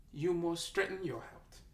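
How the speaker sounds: normal talking voice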